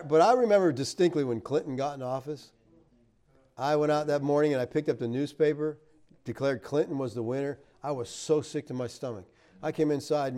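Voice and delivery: usual speaking voice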